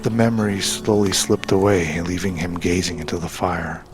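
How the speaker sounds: ordinary delivery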